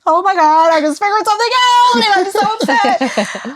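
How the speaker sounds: high, loud, tearful cry-shout; upset crying call. Retold or imitated. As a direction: relive the panicked sobbing phone call. Retelling panicked call to friend.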